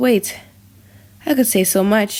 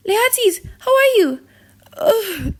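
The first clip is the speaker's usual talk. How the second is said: much higher, crying voice; pained cry; 10 semitones higher than usual. Acted. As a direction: cry out in pain and grief. Wounded Laertes asked, crying out.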